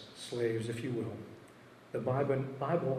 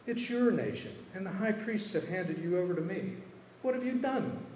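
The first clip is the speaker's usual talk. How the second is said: Pilate exclaiming; 7 semitones higher than usual. Acted. Pilate questions Jesus before him, high agitated exclamation. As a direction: push it up high, incredulous and pressing. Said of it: "exclaimed Pilate".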